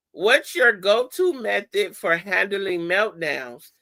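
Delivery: ordinary talk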